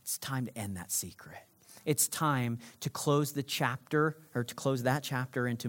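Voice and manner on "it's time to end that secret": very soft, low and hushed; hushed message. Acted. Preacher voicing the Spirit, very soft and hushed. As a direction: let it land as a gentle secret. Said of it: "Holy Spirit is saying".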